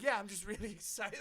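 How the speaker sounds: high, laughing cover-up. Retold or imitated. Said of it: "I was like".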